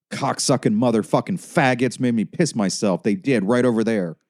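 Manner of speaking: high, loud ranting patient voice; crazed patient. A narrator's reading. Tammy raving at the nurse, high and loud. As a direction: rant like a stoned, crazed patient.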